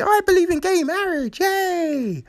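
high, squealed mock enthusiasm, rushed; gushing mock politician. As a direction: chirp it high and fast, overdoing the joy. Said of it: "now she's like".

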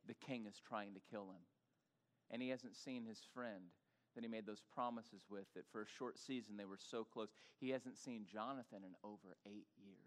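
quiet sorrowful narration; hushed and somber. Acted. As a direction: let it sink, grave and soft. Somber turn in story.

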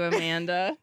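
normal talking voice

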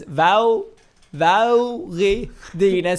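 slow, drawn-out Scanian doctor asking; mock Scanian accent, loud and slow; Scania accent. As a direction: stretch the vowels in a thick Scanian accent. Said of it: "Han snackar skånska".

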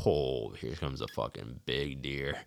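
low, slow, hushed expectant murmur; quietly bracing for a big deer; hushed hunter's thought. Retold or imitated. Hunter hearing clunks in the woods, retold as a slow hush.

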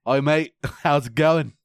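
hammed-up Australian greeting broken by a laugh; Australian accent. Echoing a friend's Hugh Jackman bit, mock Australian accent. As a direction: put on a playful Australian accent, amused. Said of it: "hay un cabrón que es australiano".

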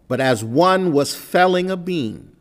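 loud, high scripture proclamation; scripture reading. Acted. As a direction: proclaim the verse to the congregation. Reads the verse aloud.

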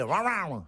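imitated Texan noise, like a yapping dog; garbled mock-Texan mumble; mimicked garbled Texan. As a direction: swallow the words into a mock Texan yelp. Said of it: "Et il fait".

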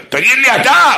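high, loud yell at him; loud turned-around yell; yelled back. Retold or imitated. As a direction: shout the question back at him. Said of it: "צעקתי עליו".

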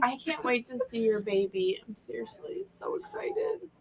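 a breathless whispered aside, voice gone; excited whisper. Real, not acted. Whispering to Carla, too excited to speak up. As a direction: hush it like a secret you can't hold in.

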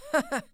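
high, loud cackle of the charwoman; high loud gleeful cackle; cackling laugh. A narrator's reading. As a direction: cackle with glee over the spoils. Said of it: "laughed the same woman".

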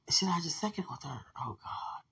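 unvoiced muttered doubt; whispered confusion. Puzzling over the friend's birthday date, whispered.

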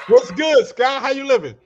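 loud, high and hyped; excited greeting. Real, not acted. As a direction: greet him with big energy.

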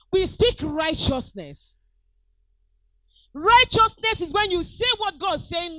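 loud, rising preacher cry on righteousness; full-force preaching. Acted. Pastor rallying church to speak righteousness, loud preaching. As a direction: proclaim it to the congregation.

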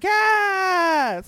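loud, high cry of joy; shouting yes on seeing Bianca; excited shout. Retold or imitated. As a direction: cheer like your favourite just appeared. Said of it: "I was like".

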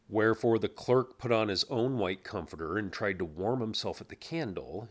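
plain reading voice

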